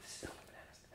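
whispered in amazement; awed whisper. Retold or imitated. Looking around the secret mansion, stunned whisper. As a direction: breathe it out, voiceless and hushed.

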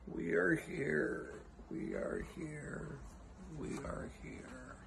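very soft, slow, drawn-out call; a tiny distant cry, fading almost to breath; faint cry. Pretend. Faint echo of crowd's cry.

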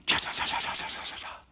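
soft, breathless cha cha chatter; unvoiced chattering hiss. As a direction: mimic a nagging voice in the head. Imitating the tempter's voice.

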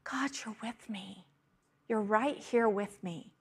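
soft intimate whisper to God; whispered prayer. Shown as a demonstration. Preacher showing the congregation how to pray, whispered. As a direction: breathe it like a private prayer.

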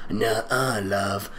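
low, soft teasing refusal; lightly voiced menacing kidnapper. Kidnapper teasing the girl, low and soft. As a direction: deny her, softly menacing.

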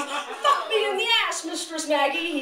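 high-pitched mock plea; crying out. Reagan begging his mistress in fan fiction, crying out. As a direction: cry it out high, hammed-up. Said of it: "he cried".

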